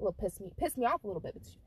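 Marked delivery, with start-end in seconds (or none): none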